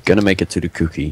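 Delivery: rushed, muttered to oneself; fast self-pep-talk; fast determined repeat. Real, not acted. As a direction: Gonna make it to the cookie said quickly under the breath.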